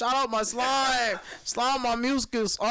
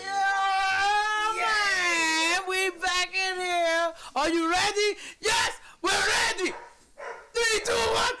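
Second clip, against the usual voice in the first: hype man; 8 semitones higher, slower than usual. Acted. Hyping up the restart.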